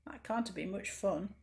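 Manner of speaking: ordinary delivery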